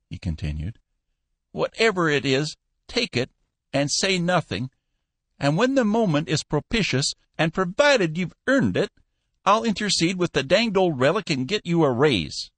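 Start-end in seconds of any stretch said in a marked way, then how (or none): 1.5-12.5 put-on high piping old man, teasing; old Cappy Ricks. Old boss teasing his new clerk, high piping voice. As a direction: squeak it out like a spry old boss.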